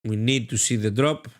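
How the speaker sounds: ordinary talk, nothing marked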